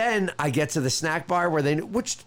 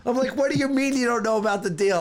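higher, mock-indignant complaint; retold exasperated protest; 7 semitones higher than usual. Man retelling his argument with the lounge desk, exasperated. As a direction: protest in disbelief, pitched up. Acting out arguing with lounge staff.

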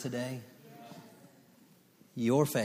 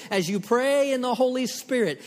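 fiery preaching; 14 semitones higher, 10 dB louder than usual. Acted. Repeated exhortation.

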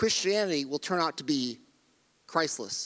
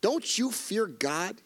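mild rebuke; 4 semitones higher, much slower than usual. Retold or imitated. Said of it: "the other criminal rebuked him".

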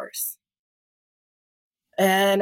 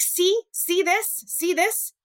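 mocking husband voice; 9 semitones higher than usual. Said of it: "And he's like".

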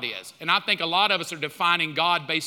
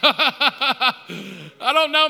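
ordinary talk

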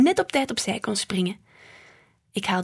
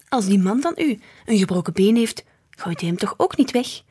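ordinary delivery

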